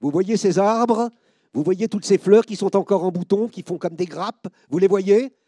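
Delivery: loud, high drill-sergeant questions; commanding officer. Retold or imitated. Officer pointing out the flowers to soldiers, loudly.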